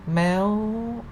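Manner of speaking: "meow" high and climbing. Shown as a demonstration. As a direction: lift "meow" steadily upward. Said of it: "represent the high sound".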